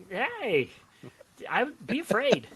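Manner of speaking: ordinary delivery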